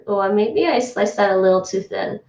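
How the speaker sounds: usual speaking voice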